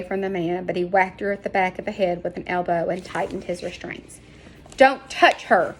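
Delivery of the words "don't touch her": loud, higher protective cry; shout. A narrator's reading. Dak shouting at the Spaniard holding Sarah. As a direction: shout him off her. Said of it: "Dak shouted".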